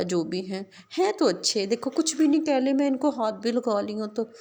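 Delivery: put-on high, lisping little girl voice; child making friends with animals; little girl cheerful. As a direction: chatter like a happy small child.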